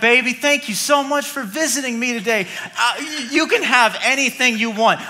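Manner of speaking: lightly voiced doting king; flirty king. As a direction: charm her like a smitten king.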